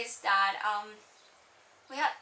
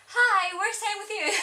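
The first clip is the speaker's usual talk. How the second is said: high, loud put-on shy greeting; much higher, louder mock-shy hello at the door; 9 semitones higher, 8 dB louder than usual. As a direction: chirp it nervously. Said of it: "so shy".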